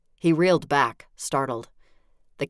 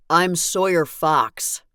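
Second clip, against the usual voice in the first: louder, slow and clear "I'm Sawyer Fox"; a deliberate, louder self-introduction; 3 semitones higher, 6 dB louder, slower than usual. Acted. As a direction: announce yourself slowly over the chatter. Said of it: "he said in a loud, clear voice".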